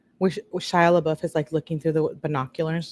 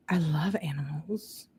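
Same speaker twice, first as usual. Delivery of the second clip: hushed, breathy aside to the viewers; whisper; 7 dB quieter than usual. Real, not acted. Host whispering to her viewers about loving animals, hushed. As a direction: let it slip out as a whispered confession.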